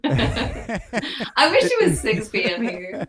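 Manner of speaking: spoken through laughter, slow; laughing. Real, not acted. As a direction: laugh while saying it. Laughing at the time.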